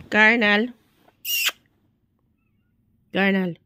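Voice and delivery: loud call by name. Real, not acted. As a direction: call out loudly to rouse a sleeper. Calling the sleeper by name.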